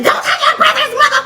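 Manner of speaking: high, loud squeaky scold; mock mom yell. Imitating Megan snapping at the baby by the sink, high. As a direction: shriek it like a frazzled mom. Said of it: "Megan was like".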